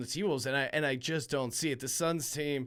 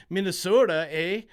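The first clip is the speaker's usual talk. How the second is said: a hammed-up Minnesota accent, louder, with a Canadian eh; 4 semitones higher, 6 dB louder than usual. Shown as a demonstration. Betting host jokingly slips into Minnesota accent, playful Minnesota accent. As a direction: lean into the Minnesota accent for a joke. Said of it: "I almost said it in a Minnesota accent".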